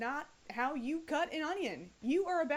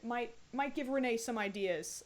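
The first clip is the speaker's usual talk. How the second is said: ordinary talk, nothing marked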